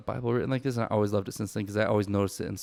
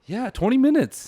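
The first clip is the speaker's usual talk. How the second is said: mock amazement; 7 dB louder than usual. Mock amazement at recording time.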